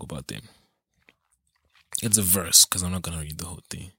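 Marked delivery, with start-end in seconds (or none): 2.6-3.9 quick unvoiced whisper, rushed; whispered aside. Real, not acted. Whispering an aside to viewers about the verse. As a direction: tuck it away as a fast whispered aside.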